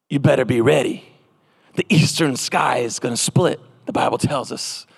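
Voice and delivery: low, measured doomsday warning; ominous preacher. Retold or imitated. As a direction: warn them in a dropped, grave pitch. Mimicking scary end-times preachers.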